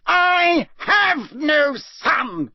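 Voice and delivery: high, very slow furious shout; enraged father shouting; furious bellow. Acted. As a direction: spit out the denial. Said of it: "bellowed Mister Crouch".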